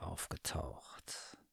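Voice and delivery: slow, breathy ominous whisper; suspense whisper. A narrator's reading. Narrator whispering as the dangerous cat appears. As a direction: drop to a hush to build suspense.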